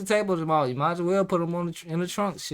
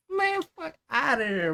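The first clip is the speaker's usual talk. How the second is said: ordinary talk, nothing marked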